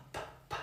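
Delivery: "pa, pa" as a soft unvoiced puff; voiceless p sound. Shown as a demonstration. Teacher showing the voiceless p sound to students. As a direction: breathe out a voiceless p. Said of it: "que es pa".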